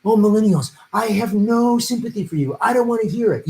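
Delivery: scornful impression of a dismissive politician; Joe Biden mocking. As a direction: brush them off in mock scorn. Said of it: "where he mocks millennials".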